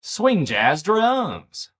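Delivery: excited, slow. Real, not acted.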